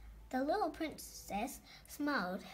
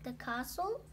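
plain reading voice

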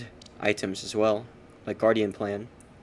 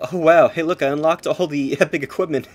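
loud, high, thrilled exclaim; excited shout; 6 semitones higher, 11 dB louder than usual. Real, not acted. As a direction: burst out with delight. Unlocks epic gear, shouts.

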